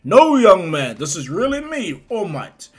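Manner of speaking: big, loud heroic proclamation; booming hero declaration; All Might. Retold or imitated. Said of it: "All Might puffs up and goes".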